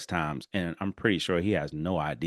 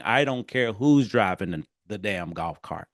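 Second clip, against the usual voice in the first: ordinary delivery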